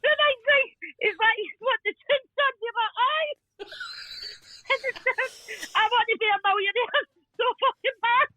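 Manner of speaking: high-pitched, laugh-choked telling; helpless laughter. Real, not acted. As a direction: keep going through a laughing fit. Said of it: "that is the most I've laughed".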